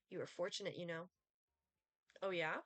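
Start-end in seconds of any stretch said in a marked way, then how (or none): none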